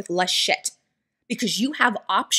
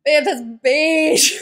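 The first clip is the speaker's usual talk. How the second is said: amused correction; 4 semitones higher, 4 dB louder, much slower than usual. Retold or imitated.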